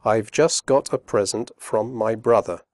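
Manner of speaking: slow and careful; careful slow read; slowly. Shown as a demonstration. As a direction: say each word clearly. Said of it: "first slowly and then quickly".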